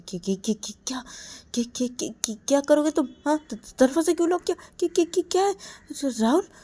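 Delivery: high, fast, stuttering panic; rising nervous stutter; frightened stammer. Acted. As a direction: stammer in sudden fear. Stammering as door is locked.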